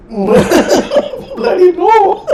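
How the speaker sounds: stretched, laughing "Bloody no!" in an imitated desi accent. Laughing, mimics clip character's retort.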